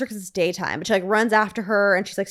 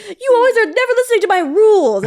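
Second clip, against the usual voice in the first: higher, louder mock mother scolding; scolding mom; 11 semitones higher, 7 dB louder than usual. Mother scolding her daughter, higher, louder mock. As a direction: nag like a cartoon mom. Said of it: "having the mom be like".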